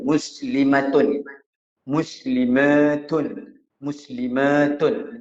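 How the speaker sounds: Muslimatun said slowly, every syllable clear, falling; slow drilled Muslimatun; careful recitation. Shown as a demonstration. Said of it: "Kita sebut biar betul-betul".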